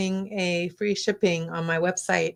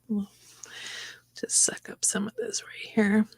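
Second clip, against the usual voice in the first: whispering; 3 semitones higher, 6 dB quieter than usual. Real, not acted. Whispering while painting detail.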